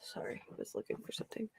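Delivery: quick soft whisper aside. Real, not acted. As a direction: whisper it fast while distracted. Aside while searching.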